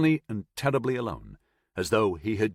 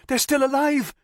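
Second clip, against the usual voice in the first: high, loud desperate cry; high shout; 5 dB louder than usual. A narrator's reading. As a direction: shriek to stop the shooting. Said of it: "higher-pitched and lacking the baritone edge".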